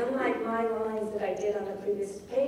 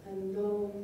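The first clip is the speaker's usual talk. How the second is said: low, falling away soft on VANISHED LIKE HIM; low-frequency O; 3 semitones lower, 7 dB quieter than usual. Shown as a demonstration. Lecturer facing the woe in the last line, low and fading. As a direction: sink low on THOUGH and fade to the end. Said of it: "O being one of the lowest frequency sounds".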